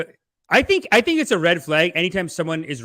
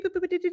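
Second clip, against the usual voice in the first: beeping; faster than usual. Retold or imitated. Mind calculating after pills.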